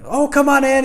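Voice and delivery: high, loud, breezy invitation; hearty retold welcome. Son welcomes him at door.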